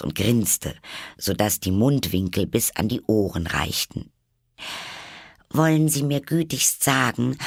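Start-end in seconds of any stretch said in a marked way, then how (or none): 5.5-7.4 slightly anxious. A narrator's reading. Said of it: "fragte Alice etwas ängstlich".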